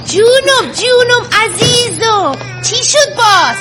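put-on cooing to baby.

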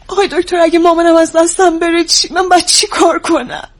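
worried daughter losing her composure; distressed pleading. Acted. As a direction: beg the doctor for help, frightened. Daughter fears losing her mother.

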